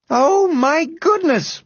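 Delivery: high, drawn-out exclamation; shocked exclamation. A narrator's reading. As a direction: exclaim in dismay at what you see. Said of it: "exclaimed Missus Grumby".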